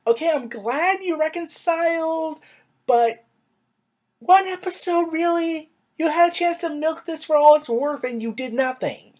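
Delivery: incredulous complaint. Retold or imitated. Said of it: "and I'm like".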